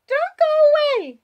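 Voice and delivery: put-on very high, loud child's cry; pleading child. A narrator's reading. Child shaking Wallace awake, high pleading cry. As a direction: plead loudly as a small girl.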